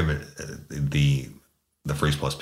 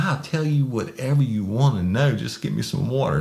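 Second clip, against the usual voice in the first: high, eager pleading promises; pleading suspect; 8 semitones higher than usual. Retold or imitated. Suspect promising the sergeant respect, high and pleading. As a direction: grovel and promise, voice way up.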